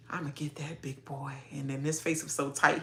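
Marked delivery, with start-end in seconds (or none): 0.1-1.3 mock murmur.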